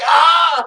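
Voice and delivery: high startled shriek; retold shriek of fright; scream. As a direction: yelp like you got a fright. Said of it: "she was like".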